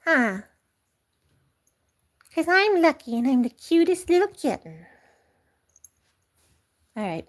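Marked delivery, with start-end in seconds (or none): 2.3-4.9 high, smug kitten voice; the cat Lucky boasting. Pretend. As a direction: boast you are the cutest. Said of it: "Lucky, he's taking over".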